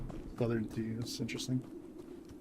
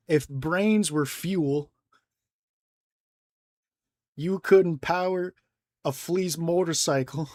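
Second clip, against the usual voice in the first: put-on slow, drawn-out Southern accent, louder; 4 semitones higher, 5 dB louder than usual. Quoting a Southern expression to a friend, in a slow Southern accent. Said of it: "a Southern expression".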